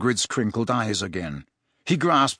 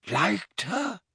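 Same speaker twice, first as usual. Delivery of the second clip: tearful; 7 semitones higher, 6 dB quieter than usual. A narrator's reading. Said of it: "eyes brimming with tears".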